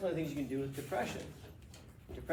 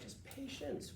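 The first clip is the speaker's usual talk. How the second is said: calm hush; 9 semitones higher, 13 dB quieter, much slower than usual. Retold or imitated. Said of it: "I'm like".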